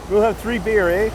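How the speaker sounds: put-on a playful Canadian accent, teasing. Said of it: "Ask any Canadian".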